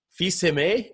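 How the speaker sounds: "fils aimé" said slowly, fee-zeh-MAY; a guest explains that "fils aimé" is French, fee-zeh-MAY; French pronunciation. Shown as a demonstration. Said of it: "in French is".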